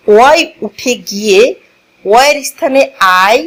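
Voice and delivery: ordinary talk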